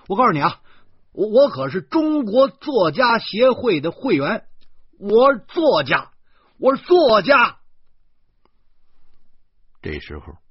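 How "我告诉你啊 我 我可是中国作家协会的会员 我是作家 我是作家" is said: loud, high, rising protest; yelling to be taken seriously; indignant shouting. A narrator's reading. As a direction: shout your status louder each time. Protesting he is a writer.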